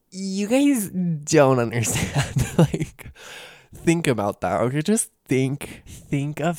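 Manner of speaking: high, drawn-out, giddy plea; overwhelmed gushing. Real, not acted. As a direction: stretch it out high and loud, laughing after. Overwhelmed gushing about celebrities present.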